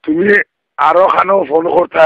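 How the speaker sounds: ordinary talk, nothing marked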